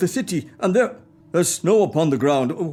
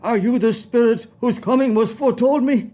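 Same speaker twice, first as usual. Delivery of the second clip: startled fear; 5 semitones higher than usual. Acted. Scrooge startled by the ghost.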